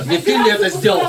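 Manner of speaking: high, loud accusing question; bulldog's suspicious voice. Retold or imitated. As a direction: play the dog asking who did it. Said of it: "вскакивает и начинает внимательно всем смотреть в глаза".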